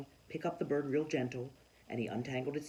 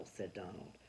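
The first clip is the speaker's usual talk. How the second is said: usual speaking voice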